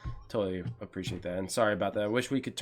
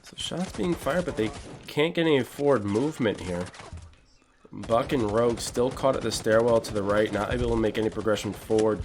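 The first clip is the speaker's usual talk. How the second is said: play-by-play; 6 dB louder than usual. Acted. Live match commentary.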